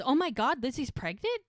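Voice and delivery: high, loud gasp of news; excited surprise. Retold or imitated. Mel hears Lizzie is pregnant, loud and surprised. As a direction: burst out with delighted shock.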